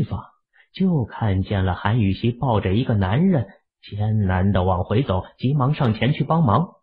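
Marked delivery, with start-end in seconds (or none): none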